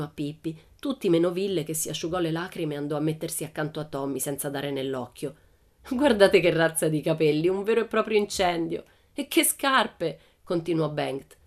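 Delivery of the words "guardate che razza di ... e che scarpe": mocking. A narrator's reading. Bengt ridiculing Pippi's hair and shoes, mocking. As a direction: make fun of her for the gang. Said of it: "si piegava in due dalle risate".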